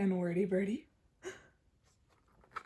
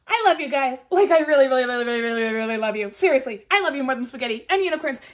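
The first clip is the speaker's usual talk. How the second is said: loud, gushing, speeding up at the end; 7 dB louder, faster than usual. Acted. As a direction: pour out your love in a rush. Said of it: "She says".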